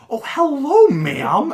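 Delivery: mock front-desk greeting, very high and loud; hammed-up polite squeal. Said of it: "D and I will talk like this".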